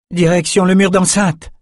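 high, urgent call; shouted direction. A narrator's reading. Lila directs Bannon as they flee, shouting. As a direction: call out the escape route. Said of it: "cria Lila".

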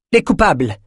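high, viperish jab, fully voiced; venomous. A narrator's reading. Councilwoman naming the culprits, venomous high-pitched retort. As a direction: spit it out with venom. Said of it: "siffla Thora, plus vipérine que jamais".

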